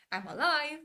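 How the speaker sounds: high, loud acted cry; imitated theatrical shout; mock exclamation. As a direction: call it out like a dramatic reveal. Quotes actor confronting Aemond in scene.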